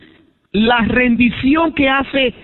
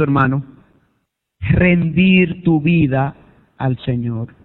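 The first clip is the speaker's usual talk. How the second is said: usual speaking voice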